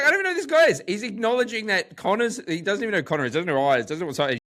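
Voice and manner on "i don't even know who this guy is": much higher and louder, wide-eyed disbelief; amazed disbelief. Retold or imitated. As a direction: blurt it out, stunned by a stranger. Retelling a baffling bar encounter.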